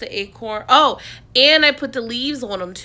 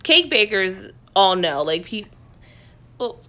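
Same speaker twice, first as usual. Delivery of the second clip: ordinary talk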